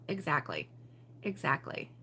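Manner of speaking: a low, soft "Exactly." in a hint of Canadian; Canadian-sounding. Real, not acted. Agreeing with a viewer, in a hint of Canadian. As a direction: slip into a slight Canadian lilt. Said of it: "That sounded Canadian".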